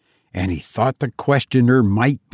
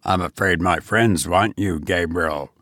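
low, mock-regretful goblin voice; ominous goblin; 6 semitones lower than usual. A narrator's reading. Goblin warning Gabriel his friends want him, low and ominous. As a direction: break it to him deep and measured. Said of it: "said the goblin".

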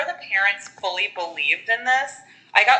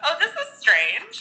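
mock mom voice; 7 semitones higher than usual. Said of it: "the way she says it is so fucking funny".

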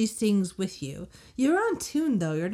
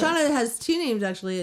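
ordinary delivery

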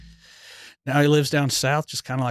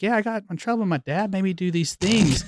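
higher, lightly voiced child telling on Dad; imitating a young daughter; 7 semitones higher than usual. Daughter to her teacher about Dad, childlike tattling. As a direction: play a kid tattling, pitched up.

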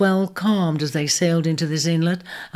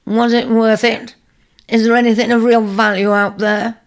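challenging; 5 semitones higher, 6 dB louder than usual. A narrator's reading. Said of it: "one elder challenged".

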